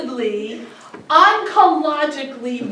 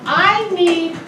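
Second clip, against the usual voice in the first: ordinary delivery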